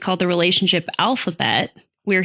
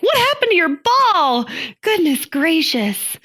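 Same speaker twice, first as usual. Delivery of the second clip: shocked; 9 semitones higher than usual. Retold or imitated. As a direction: react like a startled mom. Retold shocked reaction to kid.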